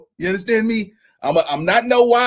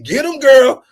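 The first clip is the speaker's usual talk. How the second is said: teasing cheer; 5 semitones higher, 6 dB louder, slower than usual. Real, not acted. Cheering mockingly.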